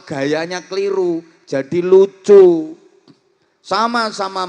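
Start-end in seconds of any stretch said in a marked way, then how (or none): 1.4-2.8 very loud, slow and drawn; comic loud stress on lucu; loud punchline. Acted. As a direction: land the joke loudly. Punchline of sermon point.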